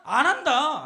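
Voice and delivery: loud, emphatic answer; loud refrain. Shown as a demonstration. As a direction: belt out the answer. Loud refrain answering own question.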